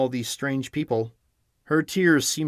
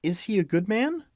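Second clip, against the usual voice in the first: anxious question; 9 semitones higher than usual. A narrator's reading. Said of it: "inquired the girl anxiously".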